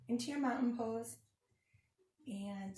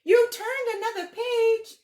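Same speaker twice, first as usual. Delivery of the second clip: much higher and louder, a hammed-up cry of alarm; mock dismayed shout; 13 semitones higher, 14 dB louder, slower than usual. Grover shouting at the children for turning the page, hammed-up alarm. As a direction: yelp at the reader for turning the page.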